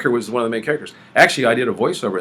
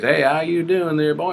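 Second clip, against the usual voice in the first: slow, higher Texan drawl; Lawrence Harvey's Texan; 7 semitones higher, slower than usual. Retold or imitated. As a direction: imitate an actor's drawn-out Texas accent. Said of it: "so he talked like this".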